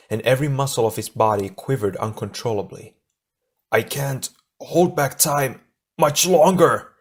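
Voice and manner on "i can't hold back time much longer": high, slow strained gasp; gasping with exertion. A narrator's reading. Tahu struggles to hold back time, gasping with exertion. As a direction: force it out under a huge strain. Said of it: "Tahu gasped, his voice twisted with exertion".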